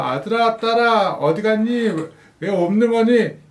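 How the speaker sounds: high calling, slowing at the end; playful search call. Acted. Dad searching the kitchen for hidden kids, mock puzzled call. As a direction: pretend you can't find them. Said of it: "이런 얘기를 합니다".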